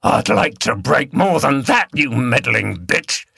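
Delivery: man's voice through gritted teeth. A narrator's reading. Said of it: "from between gritted teeth".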